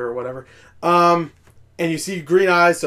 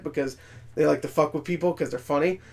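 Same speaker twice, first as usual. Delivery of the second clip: ordinary talk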